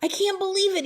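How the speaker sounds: high, loud amazed shout. A narrator's reading. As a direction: shout out in wonder. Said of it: "he shouted".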